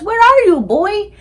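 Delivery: high, loud searching shout; father calling out for his hidden boy. Acted. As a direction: shout it with baffled urgency. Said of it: "and he shouts".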